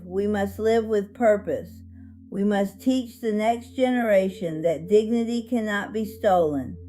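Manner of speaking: ordinary delivery, nothing marked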